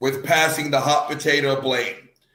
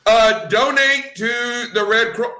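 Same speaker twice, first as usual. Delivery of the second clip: slow, high, louder mock nervous pivot; hammed-up awkward plea, higher and slower; Chris Tucker impression; 7 semitones higher, 4 dB louder than usual. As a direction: stretch it out higher and louder, awkwardly changing the subject. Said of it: "who's like".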